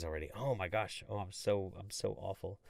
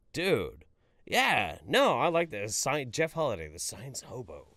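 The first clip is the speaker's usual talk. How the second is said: usual speaking voice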